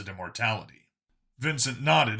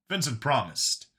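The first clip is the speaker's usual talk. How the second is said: ordinary delivery, nothing marked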